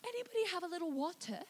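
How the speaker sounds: hammed-up understatement, small and quiet; mock timid reaction. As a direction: ask meekly, as if not wanting to bother anyone. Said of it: "you don't go".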